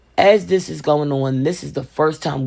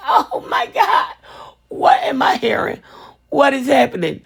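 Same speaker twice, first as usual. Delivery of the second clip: stunned aunt-niece panic, voice cracking high; shocked disbelief; 11 semitones higher than usual. Retold or imitated. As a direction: gasp it out in horror, then whisper. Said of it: "I'm like".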